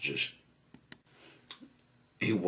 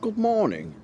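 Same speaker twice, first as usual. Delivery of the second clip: high, loud singsong hello; cheery greeting; 8 dB louder than usual. A narrator's reading. Mister Happy greets Mister Grumpy in his garden, cheerily. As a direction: greet brightly, pitch lifted high.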